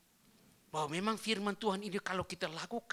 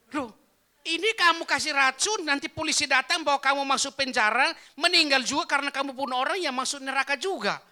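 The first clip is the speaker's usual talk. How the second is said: high, forceful acted rebuke; shouted alarmed telling-off; loud scolding; 10 semitones higher, 13 dB louder than usual. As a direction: warn them off at full volume. Pastor scolds would-be poisoners.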